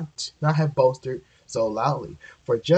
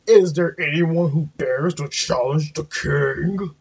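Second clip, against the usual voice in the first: louder bellowing moose; 2 semitones higher than usual. A narrator's reading. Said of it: "he bellowed even more loudly".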